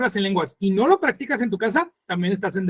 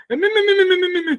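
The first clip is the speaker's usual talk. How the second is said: what a foreigner hears; 9 semitones higher than usual. Shown as a demonstration. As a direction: parrot meaningless syllables like a confused listener. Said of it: "para un japonés".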